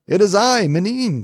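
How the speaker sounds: put-on high-pitched little girl calling out; high, girlish call in answer; little girl crying out. A narrator's reading. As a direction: cry out as a small girl, pitched well up. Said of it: "cried the little girl".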